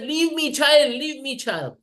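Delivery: high-pitched mock cry to be let go; retold scream. Putana pleading with child Krishna to let go, mock screaming. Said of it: "when Putana was screaming".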